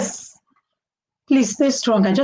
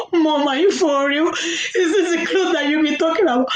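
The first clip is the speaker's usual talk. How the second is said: half-laughing mock wail to mom, pitched higher; retold crying; 6 semitones higher than usual. Daughter wailing at mom over ugly clothes, mock crying. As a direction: replay the tears with a laugh in them. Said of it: "I literally cried in front of the shop owner".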